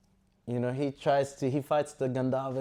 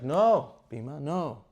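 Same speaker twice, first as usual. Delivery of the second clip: loud, higher-pitched acted "no"; firm objection; 3 semitones higher, 7 dB louder, slower than usual. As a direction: cut him off with a raised voice. Yudhisthira acts out rebuking Bhima.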